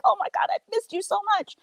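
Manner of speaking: high, fast gush; excited dad. Retold or imitated. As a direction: imitate an overjoyed dad gushing to his daughters. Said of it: "he was so excited".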